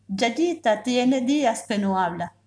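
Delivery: plain reading voice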